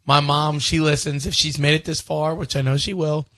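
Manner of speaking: ordinary talk, nothing marked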